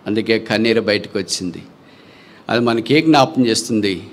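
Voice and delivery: usual speaking voice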